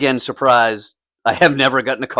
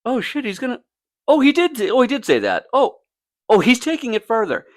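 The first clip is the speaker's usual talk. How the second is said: high, mounting disbelief; inner disbelief; 12 semitones higher than usual. Retold or imitated. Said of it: "I'm just like".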